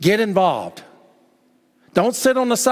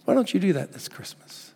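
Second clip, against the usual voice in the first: ordinary talk, nothing marked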